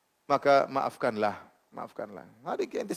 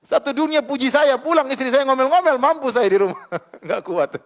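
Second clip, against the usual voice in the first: laughing; 11 semitones higher, 8 dB louder than usual. Real, not acted. Laughing joke about nagging wife.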